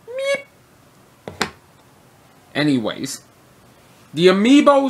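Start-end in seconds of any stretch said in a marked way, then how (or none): 0.1-1.5 squeaky meep. Acted. Employee squeaks after being cowed.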